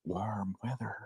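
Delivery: breathy unvoiced whisper, very soft; whispering wind. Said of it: "the wind is whispering warm weather".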